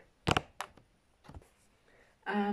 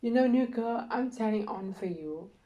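normal talking voice

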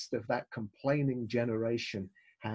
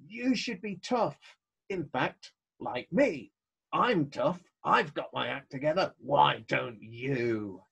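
arrogant. Acted. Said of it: "it also hints at our arrogance".

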